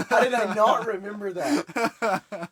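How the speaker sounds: slow, laughing disbelief. Real, not acted. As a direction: laugh through the question. Repeats question, second laughing.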